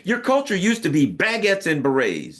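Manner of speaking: ordinary talk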